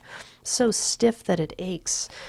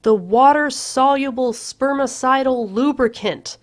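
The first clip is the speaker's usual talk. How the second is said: louder, higher shout; shout back; 7 semitones higher, 5 dB louder than usual. A narrator's reading. Patrick shouting back to Courtney from the bathroom. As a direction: fling it back across the flat. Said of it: "I shout back".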